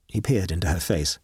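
usual speaking voice

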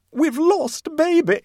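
high, loud drawn-out wail; screamed. A narrator's reading. Mother breaks the news of her lost baby, screaming. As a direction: scream it slowly in high-pitched panic. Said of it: "she screamed".

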